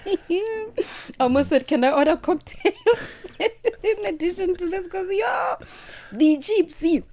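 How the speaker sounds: high voice broken by giggles; laughing while talking. Real, not acted. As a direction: talk through the giggles. Laughing while telling joke.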